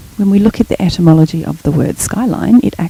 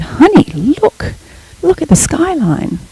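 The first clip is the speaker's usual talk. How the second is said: put-on bright high pitched call to her child, pitch rising much higher; excited; 10 semitones higher than usual. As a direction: point out the sunset with delight. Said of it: "intonation in my voice".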